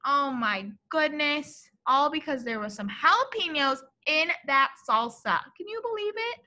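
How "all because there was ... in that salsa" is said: usual speaking voice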